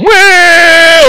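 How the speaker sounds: high, loud mock yelp of pain; a kid yelping at a rifle kick; startled yelp. Recalling the rifle's kick as a kid, loud high yelp.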